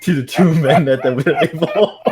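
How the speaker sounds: breaking into laughter on the last words; can't hold it together; cracking up. Real, not acted. Reader losing it while reading aloud, laughing.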